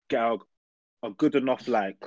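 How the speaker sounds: normal talking voice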